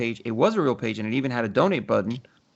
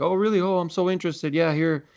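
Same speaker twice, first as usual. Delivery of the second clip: mock interest; 6 semitones higher than usual. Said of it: "I started to play around with them".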